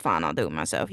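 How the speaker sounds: deep, low Thanos growl; Thanos impression. Quoting Thanos to chat in a deep growl. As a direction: drop way down into a grim Thanos voice. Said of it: "so I Thanos myself and said".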